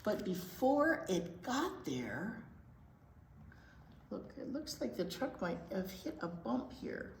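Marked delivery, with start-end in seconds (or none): 0.0-2.4 steady storytime read-aloud voice; gentle storybook narration; picture book reading. Acted. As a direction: read it to the little ones, clearly.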